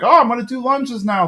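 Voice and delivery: put-on high, chipper kid voice; a boy keen to impress, voiced high; Bobby announcing lunges to his dad, high and cheerful; eager kid. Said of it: "then Bobby's like".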